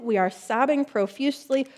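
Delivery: ordinary delivery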